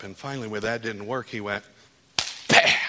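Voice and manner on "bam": explosive shouted bam; loud sound-effect shout of a door smashed; sudden bam. Retold or imitated. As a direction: slam it out like a door bursting. God breaking the door open.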